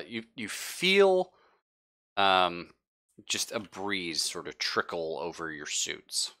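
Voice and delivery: normal talking voice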